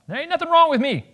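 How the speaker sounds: high-pitched, indignant denial; over-the-top touchy protest; defensive outburst. Acted. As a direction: burst out defensively, voice jumping way up. Same denial, now defensive outburst.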